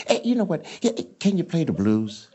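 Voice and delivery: [name] voice, playful and drawled out; [name] impression. Actor imitating [name] asking if he plays blues. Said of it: "He goes".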